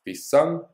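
"Pisang" said high and loud as a model word; Afrikaans word said as a demo. Shown as a demonstration. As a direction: present "Pisang" loudly to be repeated. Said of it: "let's do ending in I N G".

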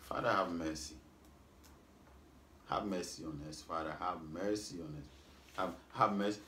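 hushed, low plea to the Father; quiet prayer. Real, not acted. Preacher pleading quietly with God for mercy. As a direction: murmur a soft plea for mercy.

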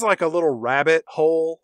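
ordinary talk

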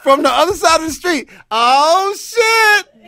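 high loud yell across the street; loud high fan yell; starstruck shout. Retold or imitated. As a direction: scream it like a fan spotting a star. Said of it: "I'm the oh shit dude".

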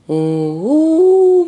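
long, high, drawn-out oh; imitating the shaman's strange cry in the night; eerie moaning call. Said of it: "막 이러면서 이상한 소리를 내는 거야".

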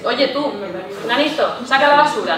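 blunt order. Shown as a demonstration. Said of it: "Y no dices".

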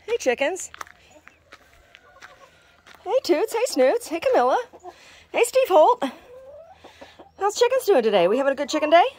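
high, loud sing-song to the chickens; pet voice. Real, not acted. Gardener greeting her chickens by name, high sing-song pet voice. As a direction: coo up high like greeting beloved pets.

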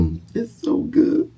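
very high, slow falsetto; high silly voice. Pretend. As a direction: squeal it with delight. Pretend eating, falsetto.

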